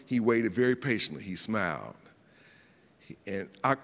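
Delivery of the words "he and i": normal talking voice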